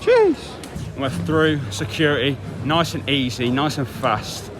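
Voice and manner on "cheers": high, loud yelp of thanks; squeaky high cheers. Real, not acted. Thanking staff at security, a high loud yelp.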